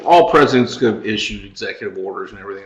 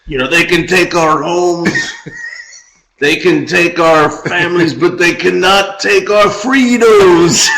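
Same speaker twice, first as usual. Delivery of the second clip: higher-pitched rallying cry, building to a drawn-out last word; rally the troops in mock-heroic style; mock Braveheart speech; 5 semitones higher, slower than usual. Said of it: "So instead of that, he's riding up and down".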